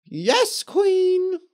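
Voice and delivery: high, loud obedient ghost witches. A narrator's reading. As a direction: squeal it like servile witches. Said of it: "said the ghost witches".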